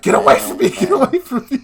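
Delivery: high, loud imitation of fending someone off; mock panicked rejection. Girlfriend pushing back the man at her door, mock panicked shout. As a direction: cry out in mock alarm, climbing in pitch. Girl repelling the man, retold.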